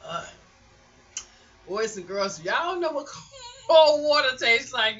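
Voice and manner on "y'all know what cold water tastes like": high, very loud burst of relish; loud delighted exclamation. Real, not acted. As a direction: belt it out, stretching the words. Exclaiming over cold water just drunk.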